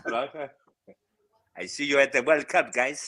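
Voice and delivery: attempted Brazilian footballer's accent, lightly put on; comic stab at Fred's accent. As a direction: have a go at a Portuguese-speaker's English accent. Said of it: "he can't do a Fred accent".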